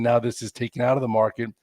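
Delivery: usual speaking voice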